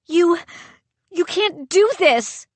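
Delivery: high, halting frightened stammer. A narrator's reading. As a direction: stumble over the words in fear. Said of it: "I stammered".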